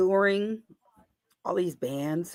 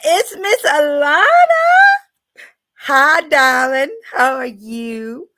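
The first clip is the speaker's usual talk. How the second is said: louder, drawn-out gushing hello; delighted greeting; 6 semitones higher, 9 dB louder than usual. Real, not acted. [name] greeting Miss Alana in chat, gushing.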